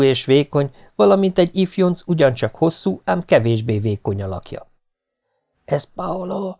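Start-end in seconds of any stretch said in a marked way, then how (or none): 5.7-6.6 soft, high hushed question; hushed, secretive question; whispered. A narrator's reading. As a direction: whisper it to your partner in hiding. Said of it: "súgta a feleségem".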